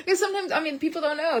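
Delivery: high, giggling through the words; laughing. Real, not acted. Joking to viewers about her signature, laughing. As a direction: laugh it off as you talk.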